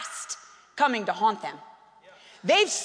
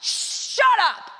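sharp stern command, a little louder; righteously angry; 8 semitones higher, 3 dB louder than usual. Retold or imitated. Caleb silencing the people before Moses, angry command. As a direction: silence the crowd with righteous anger. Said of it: "he's gotta be righteously angry".

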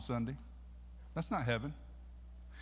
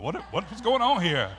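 louder, higher puzzled exclamation; mock bewilderment; 5 semitones higher, 7 dB louder than usual. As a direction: stammer out your mock alarm. Acts out his puzzled reaction to aunt.

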